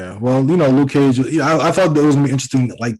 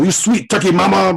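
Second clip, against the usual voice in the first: blaxploitation comic-book hero; 6 semitones higher than usual. Retold or imitated. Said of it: "catchphrases that you would normally see in".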